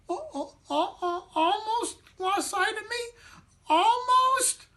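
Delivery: very high, wailing squeak; a tiny figure close to tears, voice way up; squeaky little matzo man, crying. Acted. Matzo man wailing to the children, high crying squeak.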